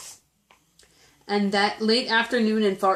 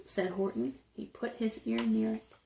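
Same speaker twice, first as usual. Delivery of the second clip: ordinary delivery, nothing marked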